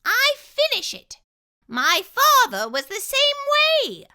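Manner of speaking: high, firm and loud; firm mouse voice. Acted. Dentist insisting on finishing, firm high voice. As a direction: say it like a stubborn little dentist. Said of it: "said the dentist firmly".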